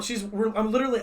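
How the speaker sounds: usual speaking voice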